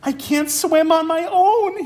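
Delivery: high, slow squeal of a scared otter cub; little otter squealing to Mom. Acted. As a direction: squeal it like a frightened pup. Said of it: "he squealed".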